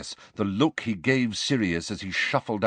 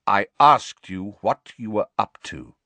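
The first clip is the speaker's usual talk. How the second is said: coldly; 2 semitones higher, 7 dB louder than usual. A narrator's reading. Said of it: "said Sirius coldly".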